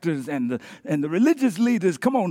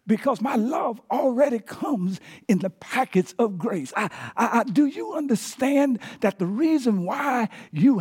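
pleading father preaching; 4 semitones higher than usual. Acted. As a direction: preach the father's love with rising force. Father pleads with older son, preached.

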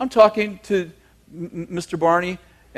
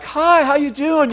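much higher, syrupy-sweet greeting; pouring it on; 8 semitones higher than usual. Retold or imitated. Greeting the neighbour who hates him, pouring on the sweetness. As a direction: lay on the fake friendliness. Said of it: "pouring it on".

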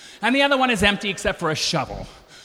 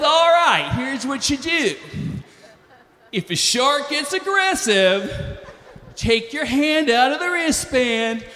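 higher, slow mock yokel captain; 3 semitones higher, slower than usual. Boat captain explains the plan, mock yokel voice. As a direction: bark it out like a laid-back boat captain. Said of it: "And he says".

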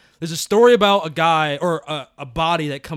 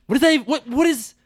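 incredulous; 10 semitones higher, 5 dB louder than usual. Retold or imitated. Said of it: "But I'm like".

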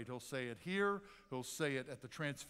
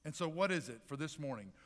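usual speaking voice

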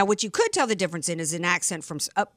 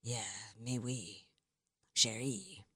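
soft, low French murmur; hushed, flirty French accent, much lower; 11 semitones lower, 14 dB quieter, slower than usual. Pretend. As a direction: coo it softly in a French accent. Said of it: "I love a French accent".

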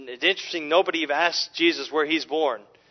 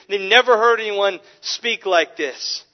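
a touch higher and louder, marvelling; amazed emphasis; 5 semitones higher, 7 dB louder than usual. Real, not acted. Preacher marvelling to his congregation, emphatic amazed tone. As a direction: lean into their amazement.

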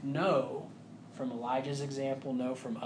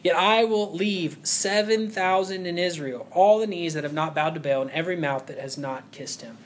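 louder, measured Bible reading; scripture reading; 6 dB louder than usual. Acted. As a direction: proclaim the verses to the congregation. Reads scripture aloud.

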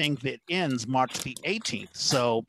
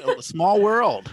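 higher, louder and drawn-out slowly; amused amazement; 5 semitones higher, 7 dB louder, much slower than usual. Real, not acted. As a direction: marvel at the coincidence, stretching it out. Amazed at the coincidence.

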